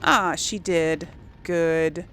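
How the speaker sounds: usual speaking voice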